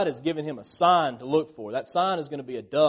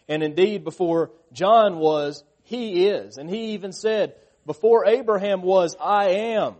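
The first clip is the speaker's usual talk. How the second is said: higher, louder fervent sermon; preaching at full force; 5 semitones higher, 7 dB louder than usual. Acted. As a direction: preach it out to the congregation.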